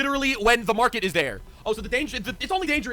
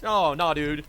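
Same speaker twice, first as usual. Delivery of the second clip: normal talking voice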